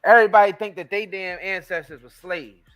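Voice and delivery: ordinary talk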